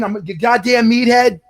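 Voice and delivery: slow, loud high meathead voice; Italian meathead. Pretend. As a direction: bellow it like a brainless tough guy. Mock Italian meathead rant.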